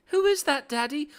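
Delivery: very high mock kid voice; little child. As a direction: squeak it like a small child asking dad. Voices Marcus's child asking Daddy.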